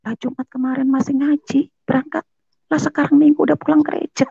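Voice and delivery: high-pitched baffled quote; bingung. Retold or imitated. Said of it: "Dia bingung dong". (menirukan orang heran, bernada tinggi)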